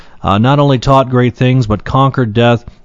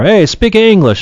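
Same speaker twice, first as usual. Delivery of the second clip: grandfather's call; 9 semitones higher, 3 dB louder than usual. Retold or imitated. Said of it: "That's what he used to say".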